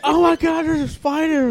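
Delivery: high, loud panicked cry; freaked-out shriek. Retold or imitated. Waitress panicking at a spider, high freaked-out shriek. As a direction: shriek it like you just saw the spider. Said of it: "freaks out".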